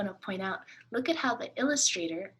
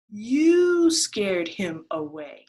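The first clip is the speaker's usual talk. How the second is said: louder, slow mock accusation; 10 dB louder, much slower than usual. Rabbit blaming the duck back, louder and slow.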